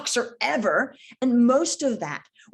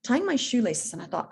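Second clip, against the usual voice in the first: ordinary delivery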